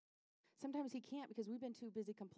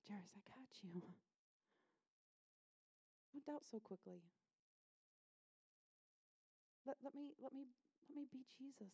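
soft, hushed fatherly comfort; tender reassurance; 5 semitones higher, 7 dB quieter than usual. Acted. Jesus gently reassuring a worried father.

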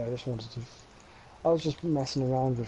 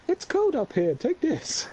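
high squeaky-pitched character quote; put-on high voice, handing something over; voice of the tower; 11 semitones higher, 4 dB louder than usual. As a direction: act out the tower in a much higher voice. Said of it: "And just went".

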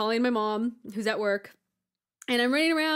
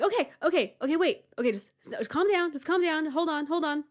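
raised, urgent hushing of a sister; retold flustered calming; 8 semitones higher, 4 dB louder than usual. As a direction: act out panicky attempts to calm someone. Retold attempt to calm screaming sister.

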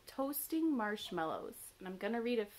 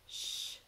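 long unvoiced shush; airy "shh"; hushing shh; 4 dB quieter than usual. A narrator's reading. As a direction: hush a tent mate. Poem character hushing tent mate.

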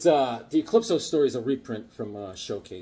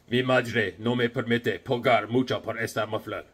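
a hint of a flat robotic Terminator, lower; lightly voiced Terminator in Spanish; understated Terminator impression; 5 semitones lower than usual. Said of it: "I pretended to be the Terminator".

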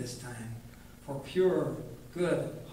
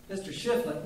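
plain reading voice

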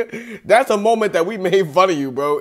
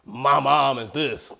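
slow, goofy mimic of the other guy; mocking imitation; 3 semitones lower, slower than usual. Mocking streamer who praised his mom, slow put-on voice. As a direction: parrot him in a dopey put-on voice. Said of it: "Talking about".

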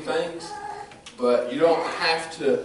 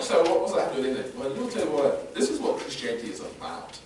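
normal talking voice

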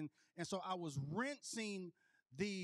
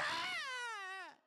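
high mock scream of pain; high falsetto ouch; retold scream. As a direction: yelp high like the needle just went in. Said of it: "I screamed".

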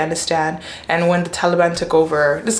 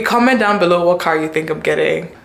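ordinary talk, nothing marked

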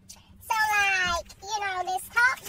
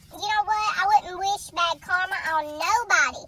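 ordinary talk

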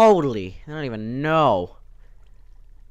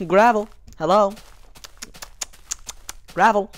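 high, loud call for the gravel; hollering a word to summon something; 10 semitones higher, 7 dB louder than usual. Acted. Player calling out to hidden gravel, high and loud.

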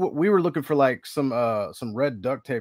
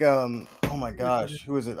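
ordinary talk

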